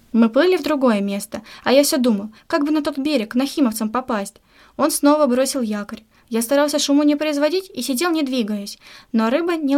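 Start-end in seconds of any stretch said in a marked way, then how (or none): none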